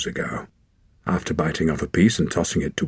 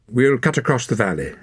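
brisk; 6 semitones higher than usual. A narrator's reading. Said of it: "she said more briskly".

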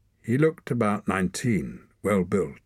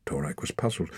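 plain reading voice

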